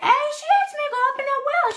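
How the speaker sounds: high, fast defensive squeak; imitating her own excuse. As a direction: whine it fast and high like a caught kid. Defends herself to grandma.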